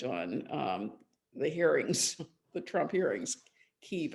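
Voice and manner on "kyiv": "Kyiv" as one syllable, keev; one long ee in "Kyiv"; newer pronunciation. Shown as a demonstration. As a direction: say "Kyiv" like keev, rhyming with sleeve. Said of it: "a different pronunciation of that city".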